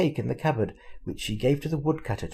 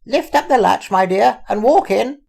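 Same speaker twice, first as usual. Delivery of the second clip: high, loud grandmother calling out; calling old granny; 10 semitones higher, 10 dB louder than usual. A narrator's reading. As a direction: call through the door like a granny.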